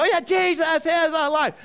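high, loud, gleeful reply; imitated kids' shout. Grandkids answering each other's news, high imitated shout. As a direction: answer like an excited child.

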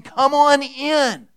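high, loud, slow come on in; God calling out a big welcome; welcoming call. Acted. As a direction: throw the door open with your voice. And God is saying.

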